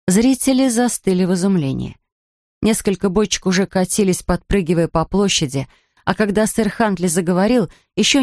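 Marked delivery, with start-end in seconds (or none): none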